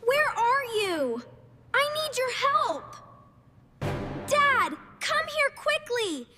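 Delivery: high, fast, urgent shouts; calling out. Acted. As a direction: yell for parents across the house. Calling parents from afar.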